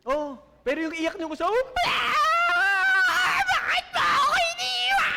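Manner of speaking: hammed-up sobbing wail, very high and loud; mock wailing widow. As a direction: wail like a grieving widow at the coffin. (pinalaking iyak ng biyuda)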